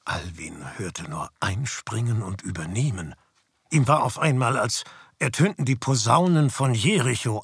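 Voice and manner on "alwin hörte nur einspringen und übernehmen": whispered narration. A narrator's reading.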